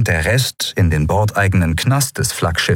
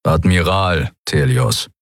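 slow clipped insistence on the title; stern admiral correcting a prisoner; grinding correction; 2 semitones lower, slower than usual. Acted. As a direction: grind out the rank through the teeth. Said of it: "knirschte der dunkle Mann".

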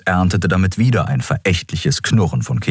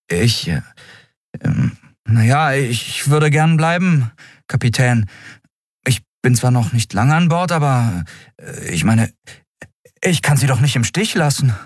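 put-on hesitant, halting, higher young voice; stammering loyalty; 8 semitones higher than usual. Miko stammers to the captain that he stays, halting. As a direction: stumble over the words but mean them.

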